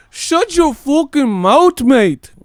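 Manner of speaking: very high, loud, slow mock yell; mock McGregor yell. Mocking a fan yelling at the vlogger like McGregor, hammed-up yell. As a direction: scream it like a raging fighter. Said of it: "Turn into Conor McGregor".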